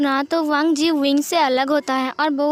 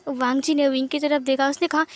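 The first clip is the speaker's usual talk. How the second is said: ordinary delivery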